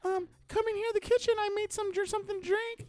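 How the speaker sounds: put-on high, sweet girlish lure; girl decoy voice. Retelling the sting decoy girl, high girlish put-on. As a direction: pitch way up like a young girl inviting him in. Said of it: "the girl's like".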